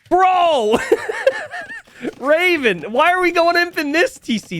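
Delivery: shouting in disbelief, very high; bro, what are you doing; incredulous shout. Real, not acted. Caster yells at a player's mistake.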